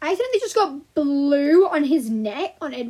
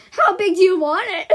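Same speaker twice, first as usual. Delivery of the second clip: loud, higher mock jab; mocking comeback; 6 semitones higher, 8 dB louder than usual. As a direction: throw it out loud and incredulous. Mocking comeback to Jessica's nose request.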